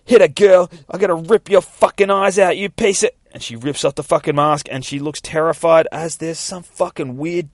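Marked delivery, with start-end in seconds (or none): 0.0-3.1 high, loud fighting yell; angry threat. Acted. Girl grappling with a ninja, angry yell. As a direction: snarl a threat mid-fight, raised voice.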